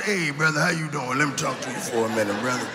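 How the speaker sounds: low mumbly hustler asking a favor; crackhead voice. Retold or imitated. Comic imitating a neighborhood crackhead, lightly voiced. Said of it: "Why they always talk like they chewing rug".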